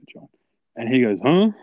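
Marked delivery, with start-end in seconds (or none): 0.1-0.3 hushed, furtive call; sly. Retold or imitated. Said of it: "I'm being very sly". 1.2-1.5 loud, high-pitched huh; imitating a mate who missed every hint; oblivious reply. Oblivious mate answers the whispers.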